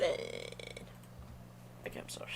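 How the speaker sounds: breathy whisper. Real, not acted. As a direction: whisper it under your breath. Whispered aside.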